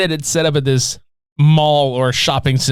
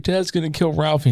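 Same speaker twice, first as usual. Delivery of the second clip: put-on higher, softer little-boy worry; little brother; 3 semitones higher, 4 dB quieter than usual. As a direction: lightly imitate a fretting small boy. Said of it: "his little brother is just like".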